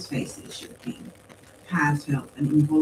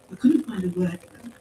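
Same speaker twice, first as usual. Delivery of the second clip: ordinary delivery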